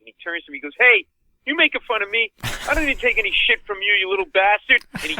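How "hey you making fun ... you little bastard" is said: higher, louder mock drunken threat; drunk guy yelling. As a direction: bark it like a drunk spoiling for a fight. Drunk bleeding guy confronts comic.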